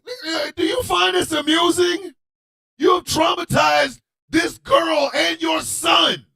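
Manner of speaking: high-pitched, incredulous mock scolding; indignant confrontation. Kid confronts the laughing father over the trauma, high indignant voice.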